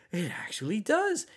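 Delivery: eerily eager, voice climbing very high on should see it; imitating a disturbed man's unsettling invitation; creepy tone. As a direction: narrator mimics the brother hinting at his project. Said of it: "that's the tone".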